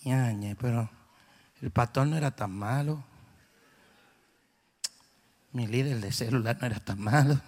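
low, mocking voice of someone who left the church; whiny ex-member. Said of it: "Y después dicen". (burla grave del que se fue de la iglesia)

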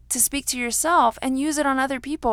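usual speaking voice

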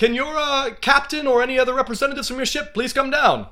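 high, loud hearty greeting; bright harbor master hailing the ship; cheery harbor master. Acted. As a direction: boom out a friendly dockside welcome.

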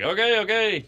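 high-pitched mock appeasing; hasty placating. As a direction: placate an explosive dad. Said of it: "my dad was pretty explosive".

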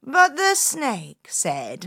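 plain reading voice

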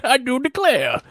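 high, slow Southern drawl; hammed-up genteel Southern accent; mock Southern accent. Repeats the mock Southern catchphrase.

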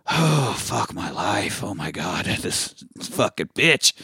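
slow, hushed weary groaning; mock despair. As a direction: grumble under the breath in comic misery. Acted passenger despairs under his breath.

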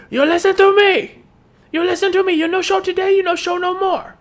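high, loud mock-furious yell with broken English; angry shouting boss. Manager yelling at the teen driver for skipping Sunday, mock furious shout. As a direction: bellow it like an irate boss.

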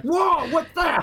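high, loud, drawn-out shocked whoa; hammed-up double take at what walks in; loud slow high-pitched exclamation of shock; mock astonishment. Reacting to surprising sight.

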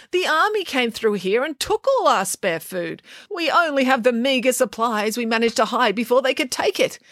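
high-pitched householder explaining a refusal; complaining householder. A narrator's reading. Householder refusing food to hungry travellers, complaining. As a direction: plead your own hardship in a high voice.

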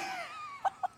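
high-pitched breathy cackle; high squealing laugh. Real, not acted. Laughs at own joke.

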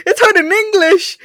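high laughing protest; laughing complaint. Real, not acted. Laughing at her struggle.

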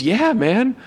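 put-on eager yes from an old friend; excited friend. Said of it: "And he goes".

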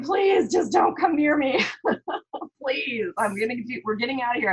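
high-pitched, half-laughing retold plea; mock pleading. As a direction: beg them off, laughing at yourself. Retold silent plea to onlookers.

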